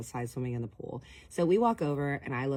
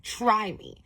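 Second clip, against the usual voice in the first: higher, firm daring challenge; defiant dare; 7 semitones higher, 5 dB louder than usual. Retold or imitated. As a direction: dare him to push it. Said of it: "looking at him like".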